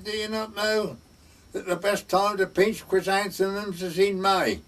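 high-pitched imitation Welsh accent. Welsh parson catching out the gardener, Welsh accent. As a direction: mimic the parson's Welsh questioning. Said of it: "in his Welsh voice".

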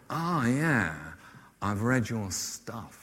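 slow, soft, drawling writer; Martin Amis drawl. Retold or imitated. Famous novelist to a chuffed younger writer, slow drawl. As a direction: imitate a drawly author, hushed and drawn out. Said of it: "quite a sort of drawly voice".

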